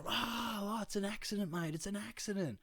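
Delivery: very high-pitched taunting echo; mocking mimicry. Bloke banging his seat throws the excuse back, mocking.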